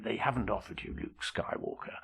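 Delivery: whispered, confiding agent; hushed bad news. Agent tells the actor on the phone, whispered. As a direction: breathe it like a secret.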